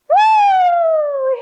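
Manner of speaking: very high, loud woo; squealing excited woo; mouse cheer. Acted.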